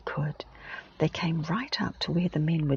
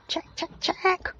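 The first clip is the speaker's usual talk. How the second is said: caws leaping much higher; a caught jackdaw crying out much higher for help; urgent jackdaw cry; 14 semitones higher than usual. Acted. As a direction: call for help like a caught bird. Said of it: "cried the jackdaw, urgently calling for help".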